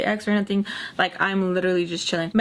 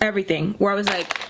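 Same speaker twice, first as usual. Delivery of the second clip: ordinary delivery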